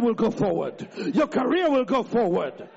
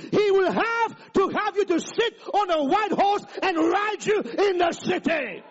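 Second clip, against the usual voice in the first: preaching at full force; 5 semitones higher than usual. Real, not acted. Pastor shouting a promise to the congregation, full force. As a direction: drive the promise home, rising.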